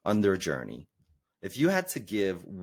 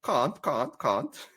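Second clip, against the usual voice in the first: mimicking his South African "can't"; a teasing echo of a South African accent; 5 semitones higher, much slower than usual. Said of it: "excuse my South African accent".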